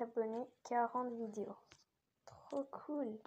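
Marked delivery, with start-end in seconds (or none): 2.3-3.2 whispering. Real, not acted. Whispered delight at channel.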